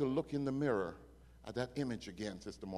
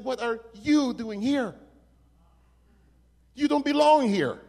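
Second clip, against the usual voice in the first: high, slow, leaning hard on "you", building louder; emphatic disbelief; 9 semitones higher, 7 dB louder than usual. Retold or imitated. Officer telling the arrested man he is misplaced, insistent disbelief. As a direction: act out an astonished officer, rising to a loud high protest.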